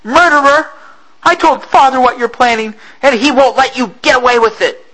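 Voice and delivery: put-on high, loud girl's scream; girl screaming at the captain. A narrator's reading. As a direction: scream it into his face. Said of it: "screamed into the captain's face".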